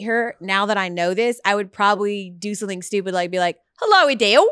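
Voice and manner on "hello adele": louder, higher mock Cockney greeting; hammy Cockney hello to a star; Cockney accent. Host pretends to greet the singer in a hammy Cockney accent. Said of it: "in a Cockney accent".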